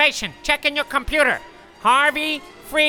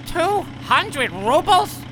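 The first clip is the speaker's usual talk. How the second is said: slow, higher, disbelieving; shocked; 7 semitones higher, much slower than usual. Acted. Harvey balks at the fare, slow shocked disbelief. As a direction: drag it out in outrage.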